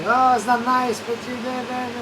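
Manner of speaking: lightly hammed-up wail; mock crying locals. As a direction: imitate people moaning online. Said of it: "Everybody start crying".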